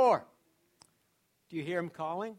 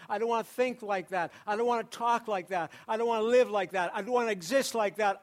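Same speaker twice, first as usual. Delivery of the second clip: fervent preaching; 3 semitones higher, 5 dB louder than usual. Acted. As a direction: drive each line home harder. Preaching at full force.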